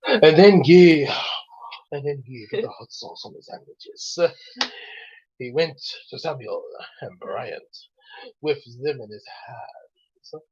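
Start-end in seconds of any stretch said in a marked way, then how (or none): 1.9-10.4 soft, near-whispered storytelling; hushed story reading. Acted. Host reading a fan story, hushed.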